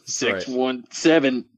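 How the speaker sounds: drawn-out, slow Boston pride; lazy slow Boston brag; slow Boston accent. Pretend. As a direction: savour it slowly like a proud Bostonian. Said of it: "Boston, M A".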